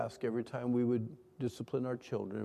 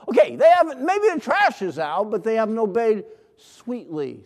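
much louder and higher, exasperated burst; raised, forceful preacher's outcry; loud exclamation; 8 semitones higher, 13 dB louder than usual. Real, not acted. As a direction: burst out loudly over the half-done job. Mock outburst about grudging obedience.

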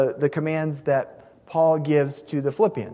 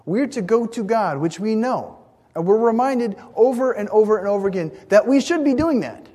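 higher, forceful preaching; emphatic exhortation; 7 semitones higher, 4 dB louder than usual. Real, not acted. Preacher reminding listeners to go to God, emphatic. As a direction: press it home, pitch lifted through the line.

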